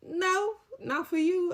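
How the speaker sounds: high, loud flat no; sharp refusal. Retold or imitated. She refuses food to the drunk coworker, high and loud. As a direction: shut the request down hard.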